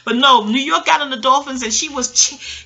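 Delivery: usual speaking voice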